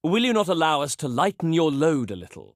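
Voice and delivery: normal talking voice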